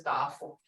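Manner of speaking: "duffel" said as dah-fel; wrong long vowel. Shown as a demonstration. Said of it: "there's no such word as".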